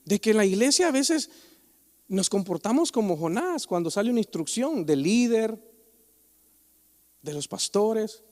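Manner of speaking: normal talking voice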